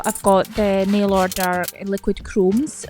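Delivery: normal talking voice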